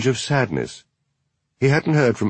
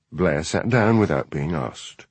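ordinary delivery, nothing marked

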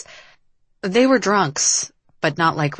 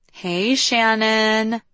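higher, long-held hello; drawn-out greeting; 5 semitones higher, much slower than usual. A narrator's reading. Liv greets the friend who came to collect her, drawn-out greeting. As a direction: stretch the greeting out slowly.

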